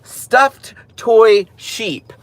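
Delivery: drawn-out label reading. Shown as a demonstration. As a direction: spell out "Stuffed toy sheep" slowly. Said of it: "and it just says".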